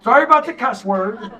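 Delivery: ordinary delivery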